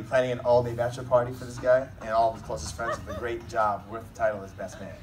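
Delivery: ordinary delivery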